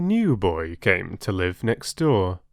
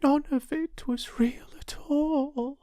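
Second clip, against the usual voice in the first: whisper to herself; 5 dB quieter than usual. Acted. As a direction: realise it quietly and sadly. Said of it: "she whispered to herself".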